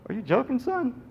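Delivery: high, disbelieving question; incredulous. Retold or imitated. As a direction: ask it like it's absurd. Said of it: "She looks at it and goes".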